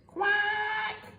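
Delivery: high quack; a tired duck's quack. Acted. Duck answers wearily.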